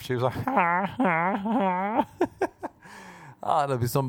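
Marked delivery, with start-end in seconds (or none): 0.3-2.0 high huhs doubling as climax cries; mock odd laugh. Woman whose laugh is her orgasm, high mock huhs. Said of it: "That was her orgasm and her laugh".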